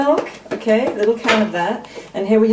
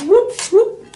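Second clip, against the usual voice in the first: playful whoop; 14 semitones higher, 5 dB louder than usual. Real, not acted. Playful cheer at the pan.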